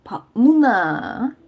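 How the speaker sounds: 부산 사투리로; Busan dialect. Shown as a demonstration. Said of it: "And for Busan".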